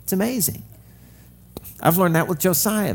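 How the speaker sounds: ordinary talk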